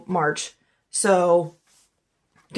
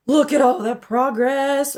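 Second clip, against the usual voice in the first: voice cracking; 6 semitones higher, 3 dB louder, slower than usual. Real, not acted. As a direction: voice cracks upward mid-phrase. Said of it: "Oh, sorry, my voice just cracked".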